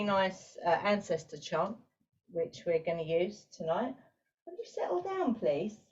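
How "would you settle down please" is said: high, soft chiding aside; aside to pet. Real, not acted. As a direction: softly plead with a pet, pitched way up. Chides a pet mid-briefing.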